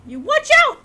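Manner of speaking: high, loud threat; shouted warning. Acted. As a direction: bark it at the rival.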